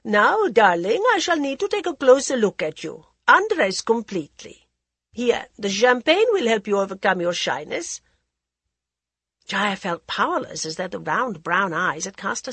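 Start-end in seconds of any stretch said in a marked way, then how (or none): 0.0-8.0 languid society lady, teasing; a drawling, worldly hostess; Lady Modi voice. Acted.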